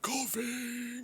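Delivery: high, thin, wheezing whisper; frail breathless old person. Pretend. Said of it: "I did the wheezing voice for the coffin".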